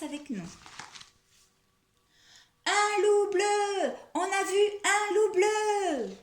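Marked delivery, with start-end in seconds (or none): 2.7-6.2 high, loud mock alarm; crying out loudly. Three friends pranking the tortoise, loud cry. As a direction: cry it high with long-held words.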